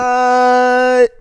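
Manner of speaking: loud, high, drawn-out disbelief; shocked outburst. Real, not acted. Co-host reacting to the driver's insult, shocked. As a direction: burst out in real astonishment.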